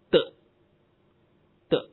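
"的" said as a short voiced d sound; isolated, repeated "的" for the d ending. Shown as a demonstration. Teacher showing the ending after voiced sounds, "的" as a bare d. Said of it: "读".

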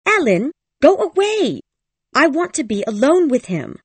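high, loud, angry command, not whispered at all; angry order. A narrator's reading. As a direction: snap the girl's order at the servant, loud and high. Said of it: "whispered angrily".

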